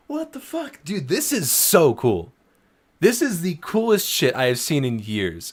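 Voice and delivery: high, loud, overjoyed; excited. Real, not acted. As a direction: gush with real delight. Overjoyed at creator in chat.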